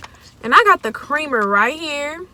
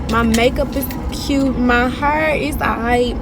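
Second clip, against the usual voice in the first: ordinary talk, nothing marked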